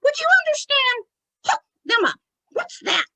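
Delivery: put-on high, puzzled little-child voice; Tommy. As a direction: squeak it like a confused child. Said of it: "talking like a little child that doesn't understand".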